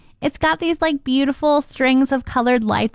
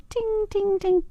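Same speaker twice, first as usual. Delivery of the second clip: needle tinkle; 9 semitones higher, 5 dB quieter, slower than usual. Retold or imitated. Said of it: "hitting off the ornaments".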